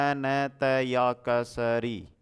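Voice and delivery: अनतयाक्षरी sounded out syllable by syllable; slow teacher spelling. Shown as a demonstration. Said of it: "अंत्याक्षरी होता है".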